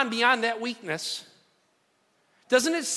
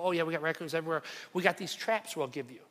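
city clerk; 4 semitones lower, 7 dB quieter, faster than usual. Retold or imitated. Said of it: "They go".

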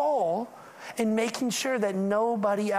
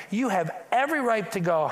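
ordinary talk, nothing marked

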